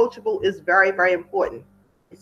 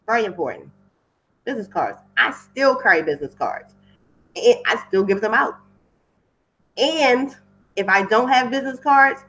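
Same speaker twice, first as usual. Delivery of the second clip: ordinary talk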